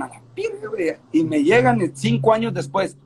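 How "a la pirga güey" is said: put-on excited kid voice. Kids thanking him, impressed.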